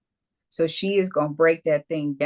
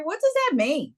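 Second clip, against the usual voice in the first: mock puzzled question; 11 semitones higher than usual. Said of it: "ask Siri".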